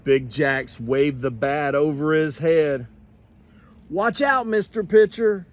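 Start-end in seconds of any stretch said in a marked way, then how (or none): 3.9-5.4 higher, louder taunt at the pitcher; swaggering slugger's taunt; cocky batter calling out. A narrator's reading. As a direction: call out a boastful warning. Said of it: "he called".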